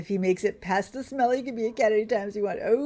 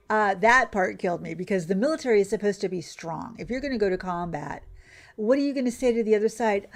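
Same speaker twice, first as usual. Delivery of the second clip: normal talking voice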